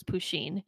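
"Pusheen" as poo-sheen, quietly; pronunciation as read. Shown as a demonstration. Host explaining how she read it, soft "Pusheen" as poo-sheen. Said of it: "that's how I was reading it".

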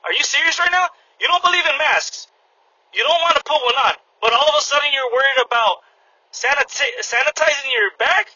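high disbelieving challenge; the cashier calls out a customer in disbelief; incredulous. Retold or imitated. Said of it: "I looked at him like".

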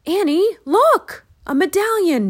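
high, loud cry of discovery; excited shout. A narrator's reading. Jack shouts to Annie up on the hilltop, excitedly. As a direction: shout to get her attention. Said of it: "shouted Jack".

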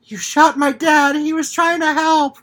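loud, high accusing cry; daughter's shout. Retold or imitated. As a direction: yell it at the sheriff. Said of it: "the daughter is just like".

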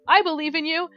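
brother-in-law calling back through the trees, high and loud; mock cheering. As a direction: cheer her on from far off, a little patronizing. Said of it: "and he goes".

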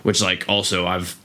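normal talking voice

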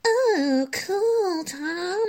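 high, slow, gushing fake awe; mock adoring girlfriend. Girlfriend humoring her boyfriend's band plans, high mock gushing. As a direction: gush over him like a bored girlfriend. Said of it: "her just being like".

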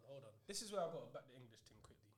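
soft whispered aside. Real, not acted. Whispering an aside to the podcast audience. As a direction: lean in and whisper it.

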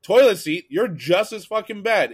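heated fan comeback at a Canucks fan; retold chirp back. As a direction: rise up and hammer the insult. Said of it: "I'm like".

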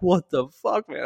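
high-pitched imitated disbelief; retold dismayed protest. Patient upside down on the litter, dismayed protest.

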